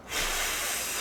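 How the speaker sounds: long, noisy huff of breath; hard blowing exhale. Retold or imitated. Imitating the fed-up roommate blowing really hard, long huff. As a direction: blow the air out hard, no voice. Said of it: "She just started blowing, like, really hard".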